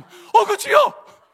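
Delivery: imitating mom's drawn-out cry of shock; shocked shout. Mother watching a drama, shouting in shock at the hero's danger. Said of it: "드라마 보다가 ... 우리 어머님".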